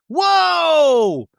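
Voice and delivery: high, drawn-out whoa; hammed-up high-pitched gasp of scandal; mock shock. Reacting to the alcoholic jab, mock-shocked.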